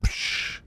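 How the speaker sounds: hushed, airy "psh" splash noise; imitated splash. Storyteller imitates the flipping car hitting water, soft splash noise.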